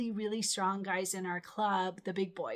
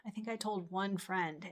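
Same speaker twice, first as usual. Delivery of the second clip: ordinary talk, nothing marked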